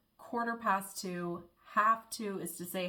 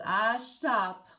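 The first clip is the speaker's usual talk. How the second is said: Cork shtop; 2 semitones higher, 8 dB louder than usual. Retold or imitated. Imitating a Cork driving instructor, loud Cork shtop. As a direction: say stop with a Cork sh sound. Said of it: "S H sound".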